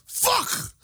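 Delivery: mock moan. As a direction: imitate an exasperated moan way up high. Mimics co-host moaning about an actor.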